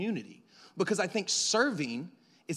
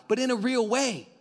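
ordinary delivery

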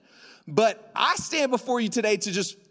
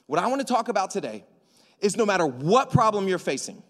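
ordinary talk

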